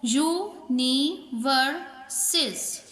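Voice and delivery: यूनिवर्सेस in four slow beats, यू, नी, वर, सेस; teacher sounding out यू—नी—वर—सेस; split into syllables. Shown as a demonstration. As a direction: say यूनिवर्सेस with a gap after every syllable. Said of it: "चार vowel sound देते हों".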